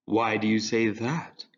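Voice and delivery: slow, lazy drawl. Acted. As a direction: draw the words out lazily.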